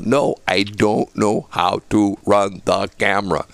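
slow, word-by-word insistence; slow, deliberate, every word separated; emphatic insistence. Retold or imitated. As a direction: spell it out slowly to a doubter. Insists to disbelieving neighbour.

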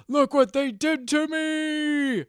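high, slow, wailing monster voice; hammed-up despair, drawn out high; mock anguished cry. Upset cameraman to the heroine, hammed-up high slow wail. Doc upset at being turned Cenobite.

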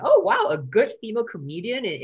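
a hammed-up, delighted discovery; mock amazed bookers. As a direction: play the wowed local booker. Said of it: "they were like".

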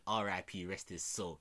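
ordinary talk

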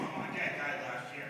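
breathy burst of laughter; helpless laugh. Real, not acted. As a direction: crack up and lose it. Breaks up laughing mid-story.

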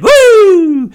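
very high, loud whooping squeal; wrestler's woo voice. Acted. Host imagining a wrestler's magazine advert, high woo voice. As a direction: whoop it, then carry on in that high voice. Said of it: "did it in the fucking weird voice".

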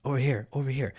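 soft, calling someone over quietly; psst for attention. Retold or imitated. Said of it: "listen to our higher self tell us".

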